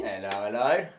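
mock cockney copper; hammy bobby greeting; london policeman. Bobby voice continues.

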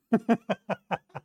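a soft amused laugh; short laugh. Real, not acted. Laughing after retelling the proposal, a soft chuckle. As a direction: chuckle at the punchline.

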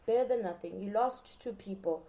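ordinary talk

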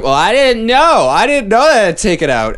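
high, loud, slow whiny mock voice; whiny clueless traveller. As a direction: whine it like a clueless passenger. Mocking clueless travellers at security.

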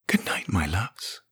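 soft tender coo, rising. A narrator's reading. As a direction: coo it lovingly. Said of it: "Fran cooed".

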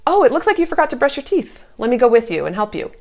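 high, fast, bright-spirited offer of help; gentle helpful tone. Shown as a demonstration. Mom offers to help her child brush teeth, cheerful and kind. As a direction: sound kind and eager to help.

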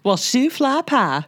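high, slow put-on Southern drawl of "shoe fly pie"; Southern accent. Friend shows her Southern accent in Southern accent. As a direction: ham up a Southern twang. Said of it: "doing a little bit of an accent".

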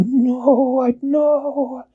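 very high, panicky penguin squeak; squeaky scared penguin. A narrator's reading. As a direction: squeak a frightened refusal. Peso refuses in fright.

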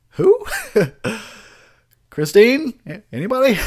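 high, loud put-on Darnell voice; Darnell character voice. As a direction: play a clueless Darnell calling out. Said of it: "go as that guy for Halloween".

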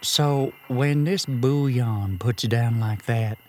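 put-on slow, gentle children's TV host voice; Mister Rogers. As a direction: talk soothingly like a kids' show host. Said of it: "I'm trying to talk like Mister Rogers".

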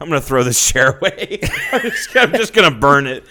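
giggling while joking about burning it; laughing. Real, not acted. Cohost laughing over the disgusting chair.

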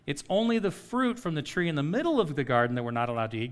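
ordinary talk, nothing marked

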